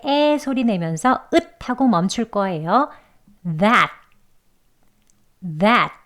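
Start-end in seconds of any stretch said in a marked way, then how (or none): 3.4-5.9 "That" cut short with an unreleased final t; stop t. Shown as a demonstration. Teacher models stop-T "that". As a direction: stop the breath at the end of "That". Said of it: "읒 하고 멈추는 stop T".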